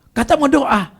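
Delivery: high-pitched, accusing jab; imitated scolding. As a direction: mimic a sister's indignant challenge. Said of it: "Dimakin lagi".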